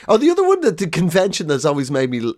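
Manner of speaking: normal talking voice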